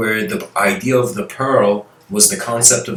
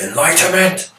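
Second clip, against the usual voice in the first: high barked command like a general; commanding shout; 9 semitones higher than usual. Retold or imitated. A general on the battlefield demands enlightenment, shouted high. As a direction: yell it like a general ordering troops. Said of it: "imagine a general on a battlefield".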